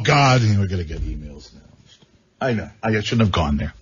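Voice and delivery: ordinary talk, nothing marked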